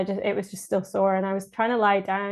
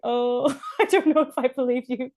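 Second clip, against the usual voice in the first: higher, laughing, doubtful reply; laughing disbelief; 5 semitones higher than usual. Retold or imitated. Answering the midwife with a higher, laughing, doubtful reply. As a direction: laugh it off, not buying it.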